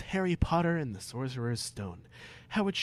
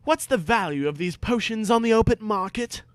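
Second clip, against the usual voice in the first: higher, keen questioning voice; eager schoolboy Harry; 9 semitones higher, 5 dB louder than usual. Acted. Harry questioning Snape in class, higher eager boy. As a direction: pipe up like a cheeky pupil. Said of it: "Snape asked, irritated".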